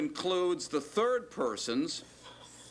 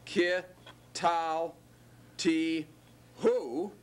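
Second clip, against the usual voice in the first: syllable by syllable; much slower than usual. Shown as a demonstration. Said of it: "the object suffix who to that".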